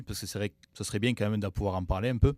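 ordinary delivery

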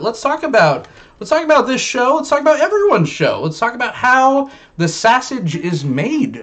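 high-pitched, escalating topic intro; showman hype. Real, not acted. Host hypes the topic like showman.

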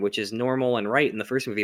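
usual speaking voice

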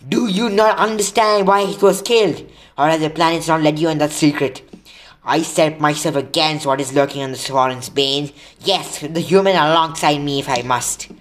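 loud, impassioned, high-pitched challenge; bellowing. A narrator's reading. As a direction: bellow it defiantly at a fellow centaur. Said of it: "Firenze bellowed at Bane".

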